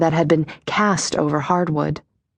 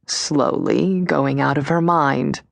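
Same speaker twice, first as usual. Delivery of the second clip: slow, hushed, low murmur; slow whisper; 4 semitones lower, slower than usual. Acted. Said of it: "More whispering".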